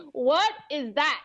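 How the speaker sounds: slow, high-pitched bafflement; retold shock. Student recalling a baffling workshop, slow high disbelief. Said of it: "in shock".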